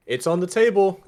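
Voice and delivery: high, loud put-on character voice; Nim. Ruff floating by mimicking Nim, high and loud. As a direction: pitch it up into a mimicked voice. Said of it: "in Nim's voice just go".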